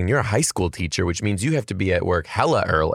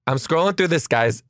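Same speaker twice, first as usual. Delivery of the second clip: usual speaking voice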